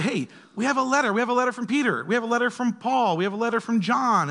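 high-pitched, excited calling out to a congregation; acted town-crier excitement; excited announcement. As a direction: announce big news to the gathered church. Acting someone announcing a letter's arrival.

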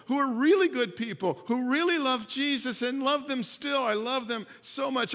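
loud, high, fervent preaching build; impassioned preaching. Acted. Pastor pleading with his church, loud impassioned preaching. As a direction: preach it with rising fire.

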